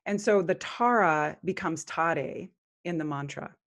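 ordinary talk

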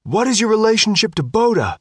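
high, loud reporter call; shouted question. A narrator's reading. Reporter to Sophia, shouting over flashes. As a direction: yell it over the crowd.

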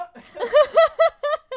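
real high, loud cackle; laughing fit. Real, not acted. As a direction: burst out laughing hard. Cracks up at the blunt line.

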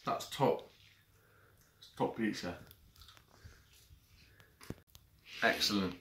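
eating between words; talking while chewing. Real, not acted. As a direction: mouth partly full. Seen 0: Holds pizza slice, bites near +0.6, keeps eating while talking.

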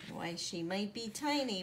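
usual speaking voice

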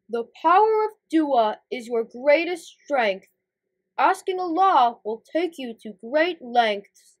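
grand, deliberate mentor voice; Big Boss figure. Acted. Big Boss teaches the heroes about dua, grandly. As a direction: pronounce it as wise counsel.

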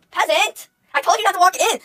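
high, loud imperious scolding; mock outraged yell. Streamer scolds someone for walking in, mock outraged yell. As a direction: bellow it like an offended ruler.

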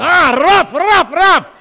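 loud, high, burred barking; Scottish dog bark. Acted. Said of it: "he even barks in Scottish".

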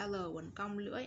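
ordinary talk

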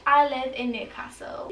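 ordinary talk

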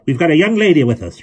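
loud, high reproving shout; calling out. A narrator's reading. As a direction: scold him on the child's behalf. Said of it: "Missus Zimmerman called".

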